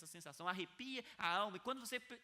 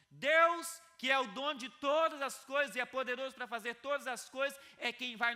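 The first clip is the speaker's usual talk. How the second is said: full-force preaching; 7 semitones higher, 10 dB louder than usual. Acted. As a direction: preach it out loud to stir the room.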